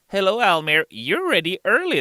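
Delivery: high, loud mock viewer. Viewer greeting the streamer, high and loud. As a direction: pipe up like an eager fan.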